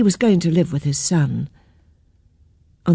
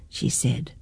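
ordinary delivery, nothing marked

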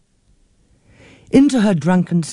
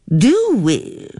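put-on high, fussy old lady near tears; a fretful elderly aunt complaining breathlessly; flustered old aunt; 7 semitones higher than usual. A narrator's reading. Old aunt complains to her niece, higher and fussy. Aunt's dry retort.

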